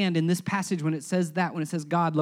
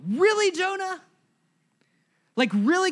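incredulous, higher pitched jab; a sarcastic "really?" to a hypocrite; exasperated disbelief; 10 semitones higher than usual. Real, not acted. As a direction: roll your eyes at Jonah. Preacher exasperated at Jonah.

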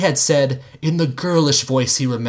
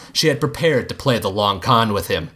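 ordinary delivery, nothing marked

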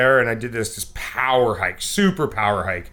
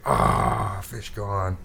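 deflated sigh; 5 semitones lower, 4 dB quieter, much slower than usual. Retold or imitated. Deflated after losing fish.

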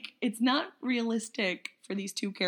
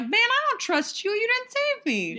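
whiny high voice; 5 semitones higher, 4 dB louder than usual. Pretend. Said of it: "I would just be like".

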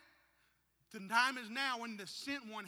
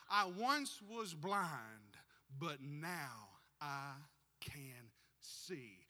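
slow quoted testimony; 3 semitones lower, much slower than usual. Acted. Preacher savouring the blind man's words slowly. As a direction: let each word land slowly.

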